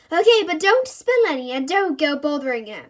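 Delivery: loud warning; stern mum. A narrator's reading. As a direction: warn him firmly and loudly.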